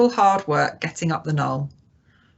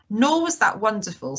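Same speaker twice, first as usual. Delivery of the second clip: ordinary delivery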